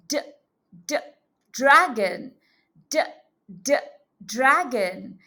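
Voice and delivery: "d, d, dragon" with the d sound split off and repeated; sounding out the first letter. Shown as a demonstration. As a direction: tap out the "d" sound, then say "dragon" whole. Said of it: "beginning sound of the word dragon".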